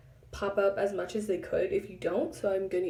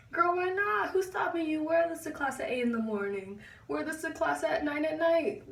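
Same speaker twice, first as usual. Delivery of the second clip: sassy, high-pitched encouragement; hyping up; 6 semitones higher than usual. Real, not acted. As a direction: cheer her on, pitched up and insistent. Playfully hyping up the viewers.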